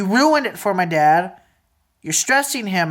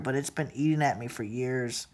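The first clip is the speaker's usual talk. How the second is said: much softer, low and heavy; quiet hurt; 7 semitones lower, 12 dB quieter than usual. Real, not acted. Telling a relative what festered for years, hushed and hurt.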